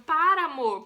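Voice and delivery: loud plea to stop; pained protest. Retold or imitated. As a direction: scold him through pain. Telling laughing husband stop. (protesto alto de dor)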